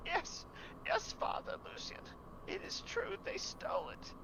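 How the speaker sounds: put-on very high, breathy frightened whisper of a child; a terrified boy barely getting the words out; scared boy's voice. Scared boy answers the priest.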